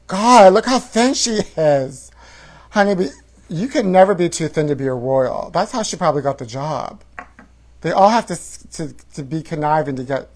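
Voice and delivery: usual speaking voice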